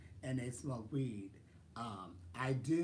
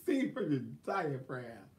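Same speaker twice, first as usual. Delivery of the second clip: ordinary delivery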